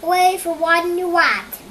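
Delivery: loud high cheer. Acted. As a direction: cheer for the hero. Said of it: "they cried".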